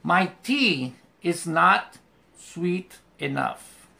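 My tea is not sweet enough said very slowly; slow dictation. Shown as a demonstration. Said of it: "como esto". (dictado muy lento)

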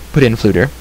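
plain reading voice

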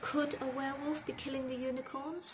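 put-on high-pitched young questioner; boy's question. A narrator's reading. Harry asks Hagrid about the unicorns, high boyish question. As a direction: lift the voice into a curious boy's.

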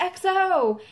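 excited mock yell; retold yell. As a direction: call it out like a fan in the crowd. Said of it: "like, yelling different stuff".

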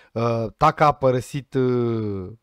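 ordinary talk, nothing marked

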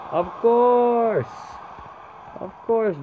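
high, loud eureka; excited realization. Real, not acted. Gamer remembering the player, high and loud. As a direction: it just clicked.

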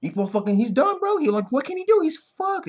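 high-pitched exasperated recap; worked-up dismay. Retold or imitated. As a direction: voice shooting up in mock despair. Relives the apparent defeat.

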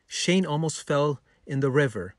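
plain reading voice

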